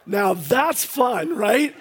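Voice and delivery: loud, high, laughing excitement; buzzing with joy, egging them on; excited laughing. Real, not acted. Pastor urging the church to shout again, excited.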